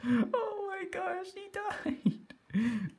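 very high, drawn-out squeal of laughter; helpless laughter. Real, not acted. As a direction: wail it while cracking up. Laughing hard as player dies.

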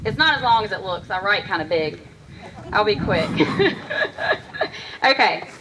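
ordinary talk

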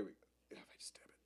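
unvoiced private whisper; hushed aside whisper. Real, not acted. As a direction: whisper it to yourself. Fiddling with camera near face.

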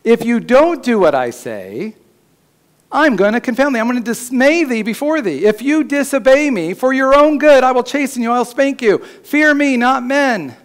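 high, loud threatening voice; stern warning God. Acted. Preacher voicing God warning the disobedient, loud and stern. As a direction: warn like a father about to punish. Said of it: "He says".

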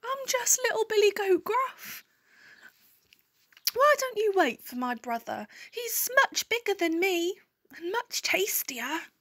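high-pitched pleading goat; squeaky little goat. A narrator's reading. Little goat talks the troll out of it, squeaky.

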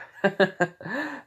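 high, awkward giggle; acted laugh. Boy caught out, high awkward giggle. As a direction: laugh it off nervously. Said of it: "He goes like".

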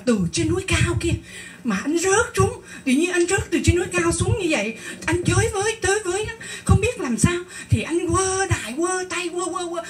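high-pitched, breathless-style dream recap; acted panic, pitched well up; frantic retelling. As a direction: play the husband reliving his fall. Husband retelling his frantic falling dream.

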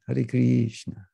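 Hare Krishna slowly, with a long flat ee in Krishna; imitated mispronunciation. Teacher imitating Russian devotees' Hare Krishna. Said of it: "pronounce Krishna very similarly".

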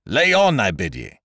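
loud, high order that drops at the end; a captain ordering the boatswain to flog on; thundering command. A narrator's reading. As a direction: bellow across the deck. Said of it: "thundered out like a trumpet across the deck".

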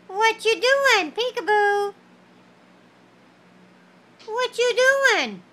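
put-on high sing-song baby talk. Talking to a baby.

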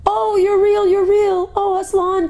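very high, ecstatic exclamation; little girl's squeal of joy; joyful cry. A narrator's reading. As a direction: cry out high with overjoyed relief. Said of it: "cried Lucy".

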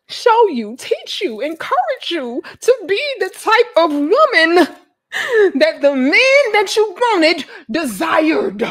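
high, loud pleading that builds to a shout; melodramatic, exasperated tirade; impassioned outburst. Pretend. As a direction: cry out each phrase, dragging the last. Impassioned rant at the ladies.

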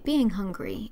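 plain reading voice

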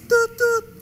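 high mouthed tut-tut beeps; imitating phone beep. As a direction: make a hold tone with your mouth.

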